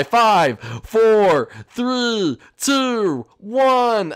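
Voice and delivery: loud, high counting, each number falling; big hollered countdown; shouted countdown. Pretend. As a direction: call the numbers out across the map. Calling out a countdown.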